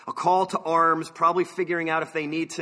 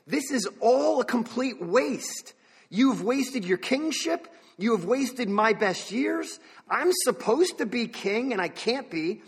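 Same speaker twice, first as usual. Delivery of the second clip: higher-pitched, slow, drawn-out lament; exasperated disbelief; 6 semitones higher, slower than usual. Acted. David protesting to Saul over his wasted years, exasperated disbelief. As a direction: stretch out each complaint in frustration. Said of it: "David just does not comprehend any of this".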